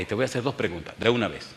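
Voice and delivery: ordinary talk, nothing marked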